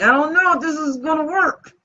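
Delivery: a mock anxious wail of doubt; retold worry. Host recalling her doubts a week before an event, worried.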